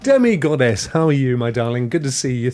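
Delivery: usual speaking voice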